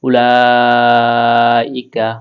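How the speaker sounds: উলাইকা with the লা stretched four alif long; long vowel. Shown as a demonstration. Teacher showing students the four-alif stretch on উলাইকা. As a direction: draw out the লা in উলাইকা very slowly. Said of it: "চার আলিফ টেনে পড়তে হবে".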